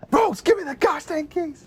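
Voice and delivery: high-pitched mock yell; desperate demand. Boyfriend demanding car keys from Rose, high frantic mock yell.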